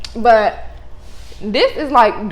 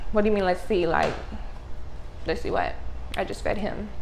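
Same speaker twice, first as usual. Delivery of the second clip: muttered disbelief; 3 semitones lower, 11 dB quieter than usual. Retold or imitated. Annoyed at the lactation consultant.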